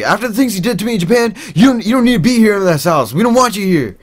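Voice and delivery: high, fast retold rant; angry rant. Manicloud blaming the narrator, high fast angry rant. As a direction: rattle it off high and heated.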